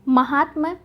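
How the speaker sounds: ordinary talk, nothing marked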